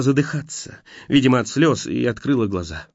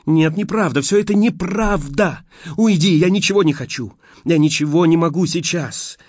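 desperate refusal; 7 semitones higher, 3 dB louder than usual. A narrator's reading. Heroine desperately refuses Steve.

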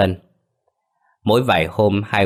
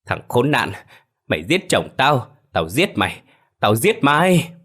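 screaming in rage; 5 semitones higher than usual. Acted. Grieving wife grabbing the killer's collar, screaming in rage. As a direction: howl it at her husband's killer. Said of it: "gào lên".

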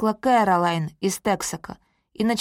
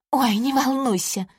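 ordinary delivery

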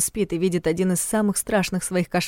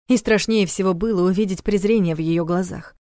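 plain reading voice

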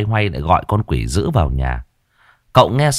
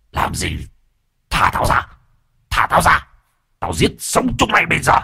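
rít qua kẽ răng; 8 semitones higher than usual. A narrator's reading. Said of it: "Nó rít qua kẽ răng".

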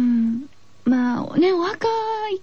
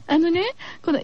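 normal talking voice

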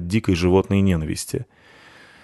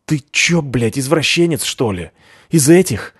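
disgusted anger; 6 semitones higher than usual. A narrator's reading. First thug disgusted.

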